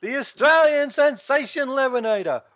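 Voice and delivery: booming ring announcer intro; ringmaster. Acted. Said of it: "let's say it right".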